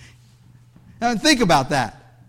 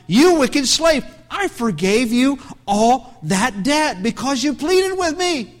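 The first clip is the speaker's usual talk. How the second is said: a touch of a stern lord, higher; stern rebuke; 5 semitones higher, slower than usual. Acted. Lord rebuking his wicked slave, stern and slow. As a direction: scold him slowly, word by word.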